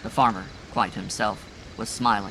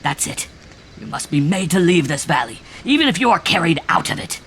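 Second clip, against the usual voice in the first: loud, strained, rising higher toward the end; strained raucous cry; 7 semitones higher, 8 dB louder than usual. A narrator's reading. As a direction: cry it out with a raw strained edge. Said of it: "he cried in a strained, raucous voice".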